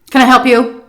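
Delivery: loud, sharp, ugly question; retold drive-through worker snapping loudly. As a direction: bark it out rudely at the driver. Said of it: "he said it really loud and, like, really just, like, sharp and ugly".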